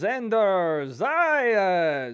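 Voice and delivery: slow, drawn-out mock Spanish-accented announcer call; imitating announcer's Spanish accent. Streamer mocking announcer's Spanish-accented intro, slow drawn call. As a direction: stretch the name like a ring announcer, Spanish accent. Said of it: "sometimes he does it in an accent".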